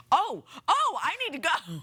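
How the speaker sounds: high, startled reenactment; retold sudden panic. Host retells noticing fifteen seconds left, high mock panic. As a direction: jump up in pitch as you realize you're late. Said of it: "I was like".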